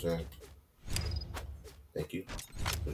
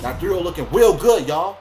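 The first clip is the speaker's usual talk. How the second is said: gloating; 10 semitones higher, 10 dB louder than usual. Real, not acted. Celebrating a 3-0.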